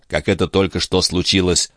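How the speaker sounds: plain reading voice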